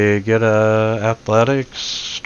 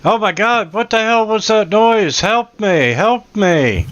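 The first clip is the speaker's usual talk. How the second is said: high, panicked cry for rescue; screaming for help; 11 semitones higher than usual. Acted. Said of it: "the guy in there screaming".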